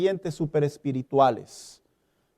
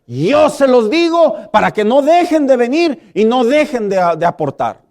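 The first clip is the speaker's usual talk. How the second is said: louder, higher preaching build; preacher's swelling denunciation; fiery preaching; 5 semitones higher, 4 dB louder than usual. Acted. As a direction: rail against greedy preachers, rising. Preaching crescendo against greed.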